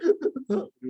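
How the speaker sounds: very high mock sobs; hammed-up high-pitched sobbing; imitated harder crying. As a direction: imitate someone crying with gusto. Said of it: "nangisnya lebih semangat juga".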